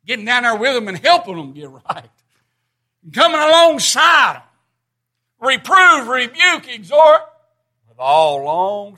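high, loud pulpit exhortation; full-force preaching. Real, not acted.

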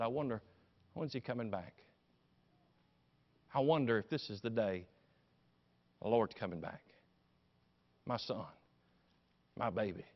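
low, soft, with long pauses; wistful musing. Acted. Mary waits daily for her son's return, soft wistful longing.

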